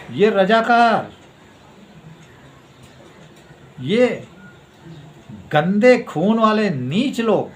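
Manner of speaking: loud, high, drawn-out denunciation; angry contempt. Real, not acted. Denouncing the Razakars with open contempt. (गुस्से और तिरस्कार से खींचकर)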